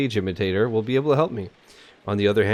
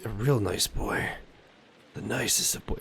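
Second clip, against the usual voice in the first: hammed-up soft fondness; 2 semitones lower, 8 dB quieter than usual. Joking aside in bookseller's role.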